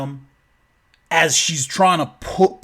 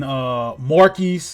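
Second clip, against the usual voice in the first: plain reading voice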